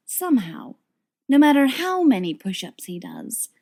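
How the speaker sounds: ordinary delivery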